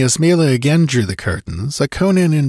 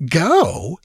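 higher-pitched startled question; startled cry; 8 semitones higher than usual. A narrator's reading. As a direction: echo the order in surprise. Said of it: "he cried, starting".

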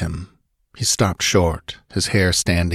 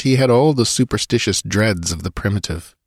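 plain reading voice

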